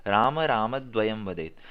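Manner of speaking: राम राम with a clear push on the closing म, slow; stress on final म. Shown as a demonstration. Teacher models राम राम द्वयम वदेत for the student, stressing म. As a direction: land on the म at the end of each राम. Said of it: "अंत में म पे जोर दिया".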